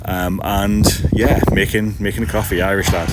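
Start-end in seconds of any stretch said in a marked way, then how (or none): none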